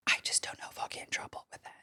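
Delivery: hushed, hurried whisper; whispered aside. Real, not acted. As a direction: whisper it quickly, wary of getting in trouble. Hushed worry about getting in trouble.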